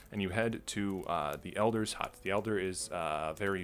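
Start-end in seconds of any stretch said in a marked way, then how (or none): none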